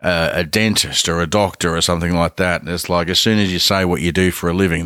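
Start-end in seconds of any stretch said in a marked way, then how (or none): none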